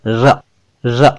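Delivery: र as a clearly trilled r; rolled र. Shown as a demonstration. Hindi teacher showing how र is said, rolled r. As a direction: roll the र with the tongue near the teeth. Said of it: "र को बोलने का तरीका".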